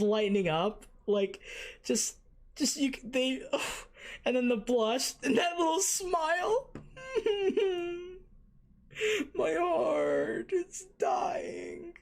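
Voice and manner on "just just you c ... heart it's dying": much higher, near tears with glee; overwhelmed squeaky delight; squealing fangirl. Real, not acted. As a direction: gush and squeal, heart melting. Fangirling over Kushina's blush.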